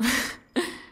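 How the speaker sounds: short creepy breathy giggle; sinister giggle. Acted. Villain laughing at captive.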